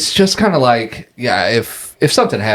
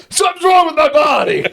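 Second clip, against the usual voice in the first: laughing. Real, not acted. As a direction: crack up while saying it. Dropped thing again, laughing fit.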